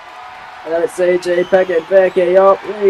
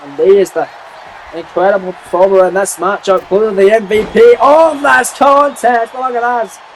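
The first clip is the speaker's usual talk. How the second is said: ordinary talk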